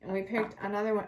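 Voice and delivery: usual speaking voice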